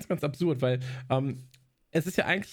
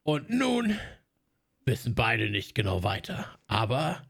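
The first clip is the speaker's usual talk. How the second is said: dramatic trailer narrator, pausing; movie trailer voice with suspense; klassischer Trailersprecher; 4 semitones lower, slower than usual. Retold or imitated. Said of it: "und dann so". (dramatische Trailersprecher-Stimme)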